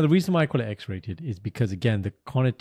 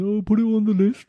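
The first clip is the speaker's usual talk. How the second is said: mimicking friend; 9 semitones higher, 4 dB louder than usual. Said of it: "And you said".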